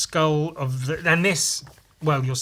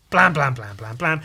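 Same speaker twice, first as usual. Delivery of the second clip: loud burst of repeated blams; imitated gunfire; 6 dB louder than usual. Gun Viking firing on cultists, loud spoken gunfire. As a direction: fire the words off like shots. Voices rapid comic gunfire.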